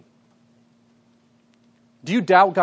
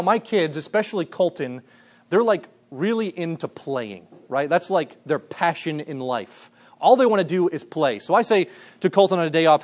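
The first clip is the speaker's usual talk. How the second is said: ordinary talk, nothing marked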